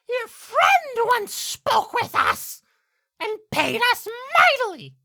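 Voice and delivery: slow, very high, loud bird voice; talking blackbird. Acted. Blackbird bargaining with the player, slow high screech. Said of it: "turns its other head and says".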